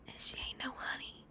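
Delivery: conspiratorial whisper; whispered aside. Real, not acted. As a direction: hush it like a secret. Whispered aside about cat.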